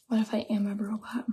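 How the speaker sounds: spooky playful whisper. Pretend. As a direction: whisper it like a secret. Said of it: "Now you're freaking me out".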